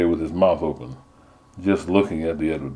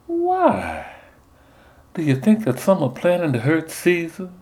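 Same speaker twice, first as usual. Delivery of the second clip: high sugary belle voice; sweet southern belle; 11 semitones higher than usual. Retold or imitated. Nun as Portia sweet-talks the Soothsayer in a belle voice. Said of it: "silky, sweet voice".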